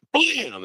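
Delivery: high sharp blam; gunshot noise. Acted. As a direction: fire the imaginary gun. Imitated gunshot.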